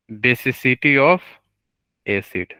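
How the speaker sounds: drawn-out term, rising on "of", pause, then "acid" drops; slow prompting. Real, not acted. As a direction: say it slowly, leaving a gap for students. Teacher slowly prompts the term back.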